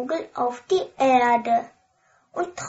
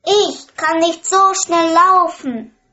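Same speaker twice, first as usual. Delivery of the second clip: high, louder plea; frog calling; 5 semitones higher, 5 dB louder than usual. A narrator's reading. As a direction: frog calling after the running princess. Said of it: "ruft der Frosch".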